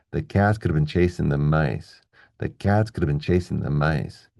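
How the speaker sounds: steady pulse on "cats", "chasing", "mice", small words reduced; regular stressed rhythm. Shown as a demonstration. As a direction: squeeze "could have been" between beats on "cats" and "chasing". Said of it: "keep a regular rhythm going".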